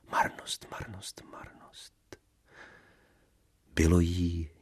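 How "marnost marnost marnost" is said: melancholy whisper, dying away; fading whispers. Acted. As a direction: let each one fade softer into nothing. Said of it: "říkala si potichu melancholicky". (melancholicky, do ztracena)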